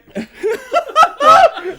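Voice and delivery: high loud laugh breaking out; cracking up. Real, not acted. Reader breaks off the fanfic, a high loud laugh. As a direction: lose it laughing.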